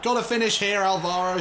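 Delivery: high, loud goal shout; excited goal call. Real, not acted. Celebrating an equaliser.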